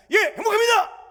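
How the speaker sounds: shouted soldier's answer; high, loud military reply at attention; korean military style. Retold or imitated. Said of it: "답은 항상 정해져 있어요".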